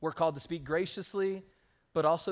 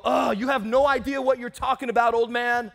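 higher, louder mocking teen putdown; disrespectful scoff; 5 semitones higher, 8 dB louder than usual. Pastor mimicking a rude grown child, high and loud scoffing. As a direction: sneer at your own father like a know-it-all kid. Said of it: "you don't degrade them and go".

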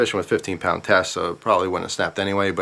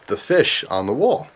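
usual speaking voice

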